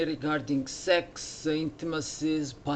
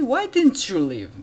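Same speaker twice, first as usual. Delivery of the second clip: raised, loud storyteller's demand; indignant challenge; 8 semitones higher, 8 dB louder than usual. Acted. Monk to the last demon, loudly acted challenge. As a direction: call it out high and loud.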